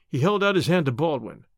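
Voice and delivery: plain reading voice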